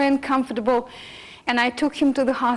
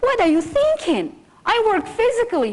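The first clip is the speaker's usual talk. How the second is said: higher, louder, indignant retort; angry husband; 10 semitones higher, 3 dB louder than usual. Retold or imitated. Husband objecting to a raw-food diet, angry. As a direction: snap back at a wife's crazy idea. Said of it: "he became very angry with me".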